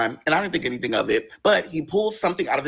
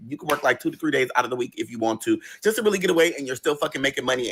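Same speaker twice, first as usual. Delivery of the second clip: ordinary talk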